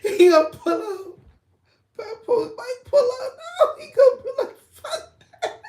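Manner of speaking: high, loud laughter breaking through the words; can barely get it out for laughing; laughing hard. Real, not acted. Vlogger cracking up while imagining [name], laughing hard.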